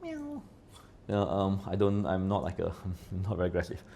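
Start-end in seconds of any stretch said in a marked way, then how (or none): 0.0-0.4 very high, soft playful meow; a tiny kitten mew; cat meow. Pretend. Calling himself a feline, tiny high mew. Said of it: "I'm a feline".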